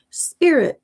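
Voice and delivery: high called-out word; mock shout. Woman passing by, yelling it out mock-loud. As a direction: call it out to someone behind you. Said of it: "extra loud".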